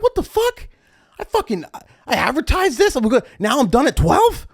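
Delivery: high, squeaky outrage; outraged rant. Retold or imitated. DJ fuming alone at the booth, high outraged rant. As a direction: let it fly up in disbelief.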